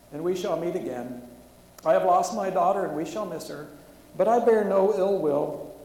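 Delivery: pushing through grief while reading aloud; emotional story reading. Real, not acted. Preacher reads a bombing survivor's account to the congregation, loud and strained.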